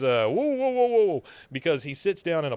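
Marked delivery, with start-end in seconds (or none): 0.3-1.2 high, loud startled Hank; imitating Hank's high alarmed whoa. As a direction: yelp it out like Hank in a panic. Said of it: "Hank, Hank says".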